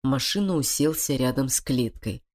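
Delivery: ordinary delivery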